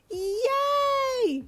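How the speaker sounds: put-on very high, loud child's yay; excited screech. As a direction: screech with joy like a little girl. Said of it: "she suddenly screeched with excitement".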